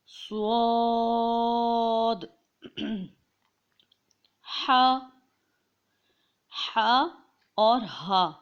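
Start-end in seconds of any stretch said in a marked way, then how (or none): 0.1-2.2 سواد drawn out long with a heavy, rounded s; thick, full suad. Shown as a demonstration. Teacher demonstrating the thick letter, long heavy سواد. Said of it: "یعنی کہ موٹا پڑھا جائے گا". 4.7-7.1 ہا as a thin breath from mid-throat; thin mid-throat haa. Shown as a demonstration. Teacher demonstrating the letter, thin throaty ہا. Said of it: "بہت ہی باریک اور حلق کے بیچ سے".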